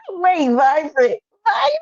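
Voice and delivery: a drawn-out, high, loud call of the name; excited shout. Real, not acted. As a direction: call out to her across the room, thrilled. Calling out to a viewer.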